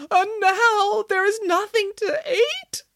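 very high, slow tearful wail; weeping. A narrator's reading. Boris with nothing to eat, high weeping wail. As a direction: blubber it out in full melodrama. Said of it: "wept Boris".

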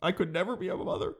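soft, high, choked-up confession; mock emotional. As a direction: say it small, as if hard to admit. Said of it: "that's hard for me to say".

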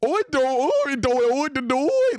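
put-on high, loud nonsense babble in a kid's voice; exaggerated high-pitched child imitation; mocking kid's babble. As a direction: squeal out silly sing-song syllables to mock a child. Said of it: "he'll fucking sound like a goddamn".

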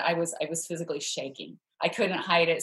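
ordinary talk, nothing marked

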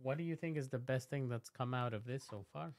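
ordinary talk

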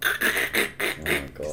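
slow breathy whisper, aghast; whispered shock. Real, not acted. Host hears his friend's record player is wrecked, slow whispered shock. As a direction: breathe it out slowly, stunned.

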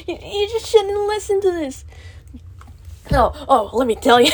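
loud excited ranting; shouting. Real, not acted. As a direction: yell it out, laughing. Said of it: "I should stop shouting".

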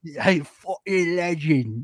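put-on slow, drawn-out British bloke accent; hammed-up British cheer; mock British accent. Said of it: "Harry Jowsey accent".

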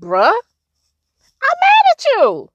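high, loud, blunt venting to God. Retold or imitated. Telling God off after her daughter died, loud, high. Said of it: "I was like".

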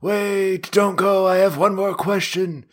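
high, desperate calling after her; pleading Merlin. Retold or imitated. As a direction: beg her to come back. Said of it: "Merlin's like".

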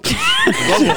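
breaks up laughing high and loud. Real, not acted. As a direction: laugh through the word. Bursts into laugh mid word.

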